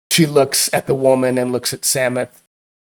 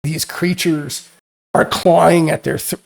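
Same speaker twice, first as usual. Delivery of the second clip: ordinary delivery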